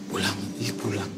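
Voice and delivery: mothers' whisper. Retold or imitated. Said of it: "teman-teman yang ibu-ibu".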